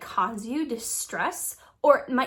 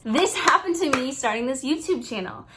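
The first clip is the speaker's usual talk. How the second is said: normal talking voice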